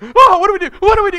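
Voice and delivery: high, loud, frantic repeat; panicked cry. Retold or imitated. Father frozen by his choking baby, panicked cry. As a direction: beg for help in pure panic. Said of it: "I immediately went".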